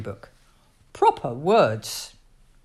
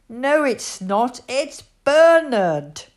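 slow, higher, emphatic correction; boy correcting toddler; 6 semitones higher, 6 dB louder, slower than usual. A narrator's reading. Bernard correcting Annie Rose, slow and insistent. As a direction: spell it out slowly, pitched up like a child. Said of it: "Bernard told her".

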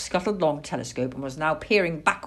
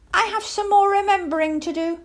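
high, loud, quick little owl voice; young owl dodging the dark; owlet making excuses; 14 semitones higher, 5 dB louder, faster than usual. Acted. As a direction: hurry out a piping excuse. Plop makes excuse to stay home.

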